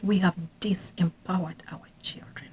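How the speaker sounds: a soft, unvoiced whisper; hushed regret. Real, not acted. Admitting parents failed their children, whispered. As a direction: let it drop to a whisper of regret. Grave point about disempowering children.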